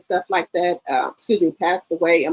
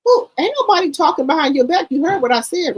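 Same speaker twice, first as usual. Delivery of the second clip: higher pitched, lightly voiced auntie; mother brushing off the kids, higher pitched; mock denial; 10 semitones higher than usual. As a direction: imitate her insisting nobody gossips. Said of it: "she said".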